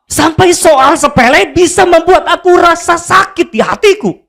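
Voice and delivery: mock-offended inner cry; indignant disbelief. Retold inner outrage. (protes batin yang dilebih-lebihkan)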